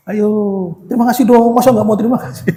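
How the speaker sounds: high-pitched mock coaxing; nagging mother. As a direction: imitate a parent pushing for thanks. Acting mothers prompting children's thanks.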